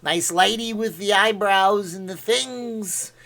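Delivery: high, slow goofy voice; Jerry Lewis. Acted. Streamer retrying for viewers, high Jerry Lewis impression. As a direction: draw it out like a zany comedian. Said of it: "How do I say it?".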